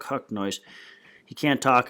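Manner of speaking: plain reading voice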